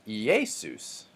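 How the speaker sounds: "Iesus" slowly with the E stressed, i-E-sus; wrong middle-syllable stress. Shown as a demonstration. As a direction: punch the middle vowel of "Iesus". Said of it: "But I'm not saying".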